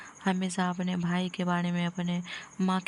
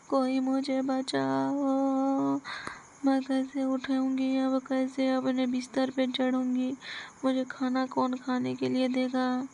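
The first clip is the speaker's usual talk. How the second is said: crying out in pain; 4 semitones higher than usual. Acted. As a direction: wail from the floor with a broken back. Fallen woman cries for help.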